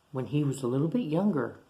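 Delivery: ordinary delivery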